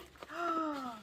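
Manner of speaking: high, soft gasp; sharp intake of real delight; excited gasp. Real, not acted. Seeing the makeup in its pouch, excited gasp.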